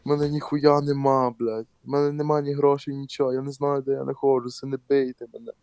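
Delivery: imitating a frightened drunk stranger; drunk pleading. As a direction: plead like a lost, scared drunk. Drunk guy pleading, voiced higher.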